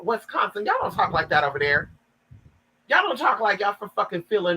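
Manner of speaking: usual speaking voice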